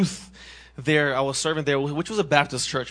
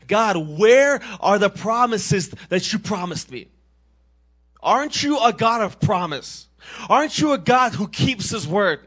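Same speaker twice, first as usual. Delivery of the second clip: yelling at God; 7 semitones higher, 6 dB louder than usual. Retold or imitated. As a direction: shout your complaint up at the sky. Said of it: "I physically yelled up at God".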